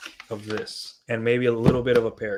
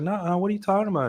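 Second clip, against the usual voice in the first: high, fast protest; defensive girl; 8 semitones higher, much faster than usual. Retold or imitated. Girl protesting a teasing remark, imitated quick high denial. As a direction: rush to deny it like she's been caught out. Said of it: "She's like".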